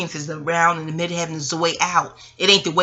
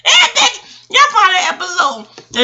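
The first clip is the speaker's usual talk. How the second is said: high, loud words through laughter; cracking up while talking; laughing; 12 semitones higher, 7 dB louder, slower than usual. Real, not acted. Telling viewers to find the episode, laughing loud and high. Laughing at the Odd Couple memory.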